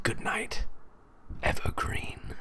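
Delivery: breathy whisper; whispered goodnight. Acted. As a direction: hush it so no one hears.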